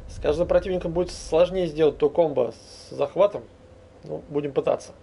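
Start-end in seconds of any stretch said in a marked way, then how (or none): none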